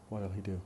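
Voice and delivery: "What'll" run together, "will" shrunk to a weak 'll; fast speech. Shown as a demonstration. Teacher showing learners reduced auxiliaries, fast speech. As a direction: slur "What'll he do" into one connected phrase. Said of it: "we say ill".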